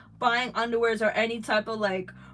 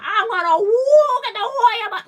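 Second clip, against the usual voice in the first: hype shout; 13 semitones higher, 12 dB louder than usual. Retold or imitated. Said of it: "it's not gonna be enough to be like".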